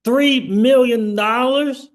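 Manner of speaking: high, loud and slow, rising in disbelief; can't believe the number; three million dollars, asked high and slow; stunned question. Real, not acted. Reacting to lost millions.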